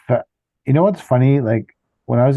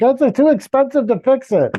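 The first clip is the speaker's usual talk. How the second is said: much higher, a light mocking voice for the mechanic; mock shop clerk; 12 semitones higher than usual. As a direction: pitch it way up like a dismissive mechanic. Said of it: "the shop will call me and be like".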